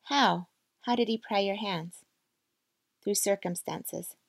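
plain reading voice

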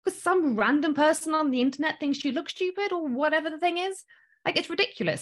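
high-pitched scoffing disbelief; incredulous. Real, not acted. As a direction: voice climbs at how absurd it is. Scoffs at fearing strangers' judgments.

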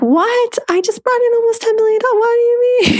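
very high-pitched, rushed mock disbelief; incredulous. Coach voicing astonishment at almost ten million, incredulous. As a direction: squeal it in exaggerated astonishment.

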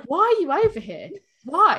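high-pitched baffled questioning; mock confusion. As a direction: imitate a bewildered bar customer. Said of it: "I think that confused him more".

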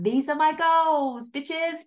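high, loud, drawn-out proclamation; playful triumphant yell, stretched out; rooftop shout. Acted. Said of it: "I'm shouting it from the rooftops".

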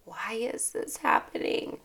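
whispered, despairing why; breathy defeated why; quiet despairing. Shown as a demonstration. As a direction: say it as a hopeless complaint. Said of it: "try reframing your".

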